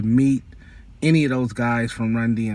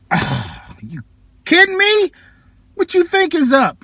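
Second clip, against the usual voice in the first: much higher, louder, strained voice; squeaky high excited disbelief; incredulous mock reply; 5 dB louder than usual. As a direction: act out "are you kidding me". Mock reply to friend's question.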